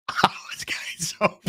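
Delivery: whispered under breath; breathless whisper; whispered aside. Real, not acted. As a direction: hush it to yourself.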